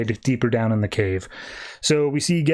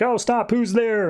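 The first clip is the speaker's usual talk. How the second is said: alarmed cops; 9 semitones higher than usual. Acted. Cops attacked by someone invisible.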